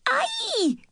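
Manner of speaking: sudden high-pitched yelp of pain; a shrill little ow of surprise; sharp high cry. Acted. Cousin yelping when her hair is tugged, high cry. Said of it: "she cried".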